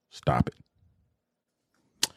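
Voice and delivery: low, whispered stop it; whispered plea. Real, not acted. To networks and producers, a low whispered plea. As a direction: breathe it out as a whisper.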